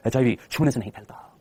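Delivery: low, slow, weighty announcer imitation; heavy TV-ad voice. Teacher repeats the TV ad for the class, heavy voice. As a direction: drop deep and drag it out like a health ad. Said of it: "ऐसे भारी आवाज में".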